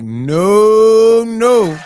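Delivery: loud, high protesting no; shocked denial. Retold or imitated. Reacting to the twist, loud high protest. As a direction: cry out no in shock. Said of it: "I was like".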